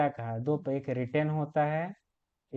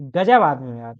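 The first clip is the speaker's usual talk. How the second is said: "गजब आदमी हो यार" said louder as a mock angry retort, hitting गजब hard; angry demo; 2 semitones lower, 9 dB louder than usual. Shown as a demonstration. Teacher showing students the same words said in anger, mock angry and louder. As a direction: snap out "गजब आदमी हो यार" like a mildly demonstrated angry jab, stressing गजब. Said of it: "वही मैं गुस्से में बोल दूँ".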